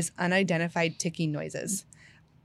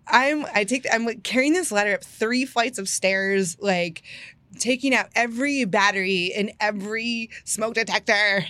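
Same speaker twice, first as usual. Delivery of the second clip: normal talking voice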